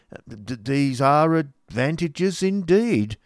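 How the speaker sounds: drawn-out polite surprise with a half smile; lightly voiced gentleman, mildly surprised; gentleman, half amused. A narrator's reading.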